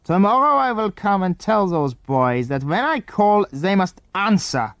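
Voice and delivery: a mildly angry foreign man lays down the law. A narrator's reading. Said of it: "in an angry voice".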